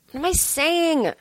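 high, drawn-out self-questioning; slow, high-pitched bafflement; exasperated outburst. Real, not acted.

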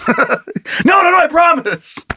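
high-pitched, hammed-up denial; protesting suspect. Suspect pinned to the wall, mock bewildered protest. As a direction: play innocent in a high voice.

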